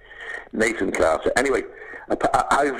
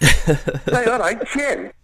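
high, louder mock call across the street; imitated shout; 13 semitones higher, 4 dB louder, much slower than usual. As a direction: call out to Jim like a passing mate, hammed up. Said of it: "they shout".

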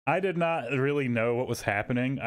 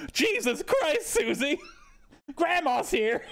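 squeaky high disbelief breaking into a laugh; mock shock. Speaker teasing Susie about Grandma, high mock shock with laughter. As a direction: yelp it up high, then laugh through the last words. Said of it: "And I was like".

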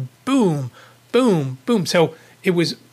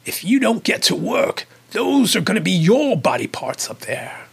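put-on English accent, pitched up; 4 semitones higher than usual. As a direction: imitate the haunt actor's English accent. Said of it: "he did this in his English accent".

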